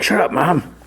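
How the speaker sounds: high-pitched imitation of an embarrassed son; mock exasperated outburst. Son silencing his embarrassing mom, exasperated imitation.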